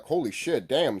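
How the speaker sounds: ordinary talk, nothing marked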